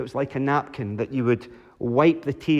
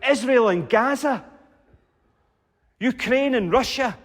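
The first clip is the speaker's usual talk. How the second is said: mock shout from congregation; 9 semitones higher than usual. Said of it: "Somebody else might shout out".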